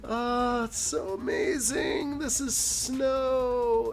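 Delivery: high, slow, starry-eyed gush; mocking awed tourists. Mocking theme park crowd gushing over fake snow, high and drawn out. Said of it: "And everyone's like".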